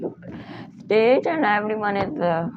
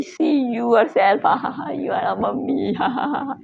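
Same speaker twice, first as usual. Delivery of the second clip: laughing back mockingly; 5 semitones higher than usual. Mocks back: you're a mummy.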